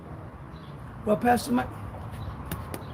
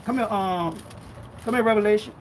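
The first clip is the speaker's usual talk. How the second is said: ordinary talk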